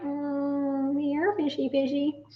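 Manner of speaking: lilting call of here fishy; coaxing fish. Retold or imitated. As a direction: sweet-talk the fish in. Coaxing the fish onto the line.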